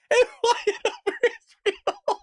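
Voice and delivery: words squeezed out through real laughter, slowed; can barely read it for laughing; laughing while reading. Real, not acted. Breaks up reading the article.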